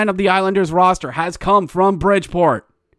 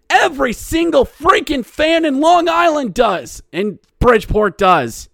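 high, exasperated rant; heated outburst; 10 semitones higher, 4 dB louder than usual. Real, not acted. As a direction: fire it back high and indignant. Heated rant answering quoted claim.

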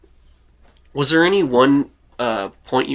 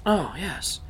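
lightly voiced character, higher and softer; a hint of a put-on higher voice; Richard's voice; 6 semitones higher, 6 dB quieter than usual. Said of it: "and Richard goes".

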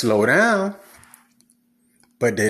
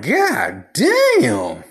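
mock exclamation; 8 semitones higher than usual. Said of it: "I'm like".

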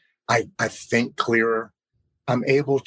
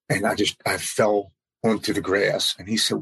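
normal talking voice

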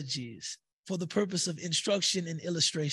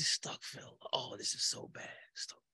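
breathless softer whisper; whispered astaghfirullah; 11 dB quieter, faster than usual. Pretend. Young man at the website, whispering astaghfirullah. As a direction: hush it while still sinning.

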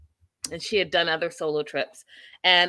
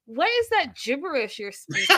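higher, lightly put-on incredulous question; mock Gio; 7 semitones higher than usual. Friend butts in on speakerphone, mock bafflement. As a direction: lightly imitate a baffled friend, breaking into laughter. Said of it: "Gio says".

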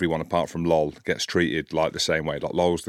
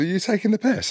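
very high-pitched disbelieving question; light, joking tone; 14 semitones higher than usual. Retold or imitated. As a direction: squeak it out, much higher than usual. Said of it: "I just said it like that".

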